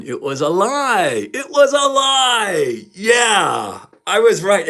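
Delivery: drawn-out, high, loud cry of vindication; triumphant shout. Real, not acted. Vlogger proclaiming he was right about the lie, triumphant shout. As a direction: crow it loudly, stretching the words out.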